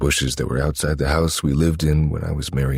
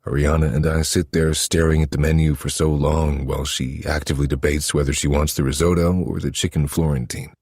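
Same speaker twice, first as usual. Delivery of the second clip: usual speaking voice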